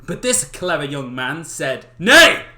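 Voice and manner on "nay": high, loud hammed-up "Nay!"; enthusiastic shout. Clever young man refusing the old man loudly. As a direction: bellow it like a hero. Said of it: "me getting overly enthusiastic".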